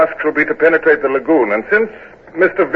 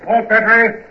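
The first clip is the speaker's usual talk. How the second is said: shouted order; 11 semitones higher, slower than usual. Acted.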